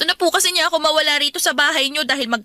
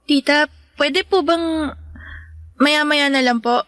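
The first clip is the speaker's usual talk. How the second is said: tired plea; 3 semitones lower than usual. Acted. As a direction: drag the words out, worn out from school. Tired girl begging aunt.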